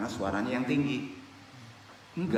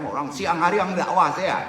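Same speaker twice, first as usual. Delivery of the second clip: loud, higher-pitched mock complaint; acted protest; 5 semitones higher, 7 dB louder than usual. Preacher imitating someone doused while proselytizing, protesting loudly. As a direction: protest loudly, half laughing.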